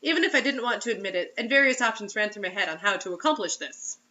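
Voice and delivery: normal talking voice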